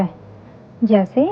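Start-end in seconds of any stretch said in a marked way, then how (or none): 0.0-0.1 "ए" as an open eh vowel; letter sound demo. Shown as a demonstration. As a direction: sound out "ए" for learners. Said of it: "E की sound होती है".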